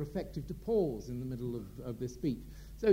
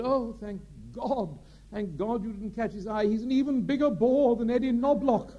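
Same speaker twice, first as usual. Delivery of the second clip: put-on a plummy old actor, thankful, then blurting a louder insult; Gielgud's relief; 7 semitones higher, 5 dB louder than usual. Sir John to his lunch guest, relieved, in a high grand theatrical voice. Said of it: "Sir John turned to his guest and said".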